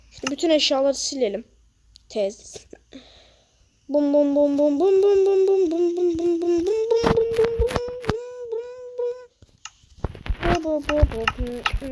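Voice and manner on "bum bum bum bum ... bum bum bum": bum sound effects. Pretend. Gleeful sound effect while destroying.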